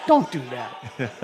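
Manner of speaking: low, slow voice of a dog trainer; trainer's correction. Retold or imitated. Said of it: "he's like".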